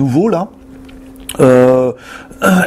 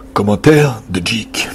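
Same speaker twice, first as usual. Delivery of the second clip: low, slow announcement; deep manly voice; 7 semitones lower, much slower than usual. Shown as a demonstration. Host re-announcing Jik's comment, deep manly voice. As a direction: drop it deep and draw it out. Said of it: "Faut que tu fasses la voix".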